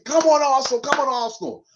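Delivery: mock shout. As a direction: rave at the telly like old times. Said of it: "I used to be shouting, raving".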